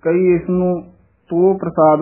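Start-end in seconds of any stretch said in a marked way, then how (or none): 1.3-2.0 ਤੋ with o, "to prasaad"; others' reading. Retold or imitated. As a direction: round o in ਤੋ before ਪ੍ਰਸਾਦ. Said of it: "ਉਚਾਰਨ ਕਰਾਉਂਦੇ ਹਨ". (ਤੋ ਪ੍ਰਸਾਦ ਹੋੜੇ ਨਾਲ)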